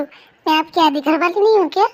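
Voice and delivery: ordinary talk, nothing marked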